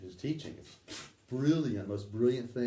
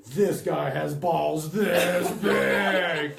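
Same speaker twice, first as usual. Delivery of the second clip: awed gunmen; 6 semitones higher, 8 dB louder, much slower than usual. Retold or imitated. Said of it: "And they're going".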